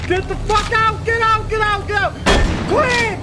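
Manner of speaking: frantic high yelling in panic; angry crying. Real, not acted. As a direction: yell in terrified frustration. Panicking while playing scary game.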